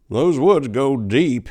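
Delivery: lightly voiced old farmer warning slowly; a gruff old-timer cautioning slowly; old farmer's warning. A narrator's reading. Old man warns the priest.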